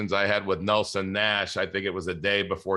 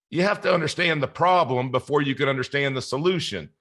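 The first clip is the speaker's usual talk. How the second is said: imitated mentor, pitched higher; Nelson Nash; 5 semitones higher than usual. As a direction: lay down advice in a raised, put-on voice. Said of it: "in perfect Nelson form".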